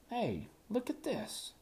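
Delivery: very high, slow squeaky little doll voice. A narrator's reading. Gum Baby calling Tristan over, high squeaky doll voice.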